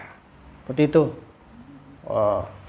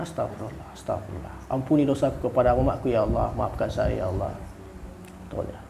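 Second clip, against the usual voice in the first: soft, low murmured prayer for her to repeat; quiet devout murmur; hushed prayer prompt; 5 semitones lower, 7 dB quieter than usual. Shown as a demonstration. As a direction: lead the prayer gently, almost under breath. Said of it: "Istighfar, istighfar, kan".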